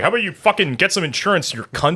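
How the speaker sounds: imitating Louis C.K. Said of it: "and he's like".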